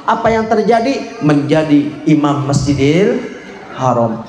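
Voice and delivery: ordinary talk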